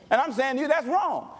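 ordinary talk, nothing marked

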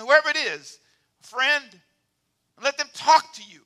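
loud, rising pulpit preaching; preaching at full force. Real, not acted. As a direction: call out to the congregation.

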